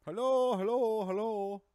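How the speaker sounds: put-on high, loud, drawn-out Indian-accented hello; very heavy Indian accent. Caller mimicking the outsourced operator, heavy Indian accent. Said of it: "in a very heavy Indian accent".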